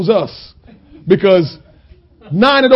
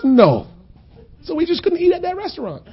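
ordinary talk, nothing marked

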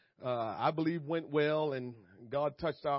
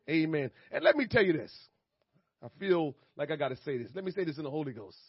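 normal talking voice

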